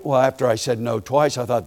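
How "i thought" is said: ordinary talk, nothing marked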